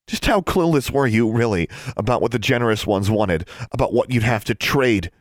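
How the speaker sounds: high, loud, indignant demand. A narrator's reading. Daughter confronting her father over the bargain, demanding. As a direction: press him hard for an answer. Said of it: "she demanded".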